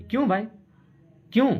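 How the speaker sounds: usual speaking voice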